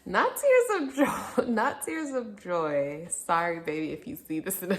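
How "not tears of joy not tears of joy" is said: laughing through the words. Real, not acted. Laughing as she tells her child about the crying. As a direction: laugh it out while saying it.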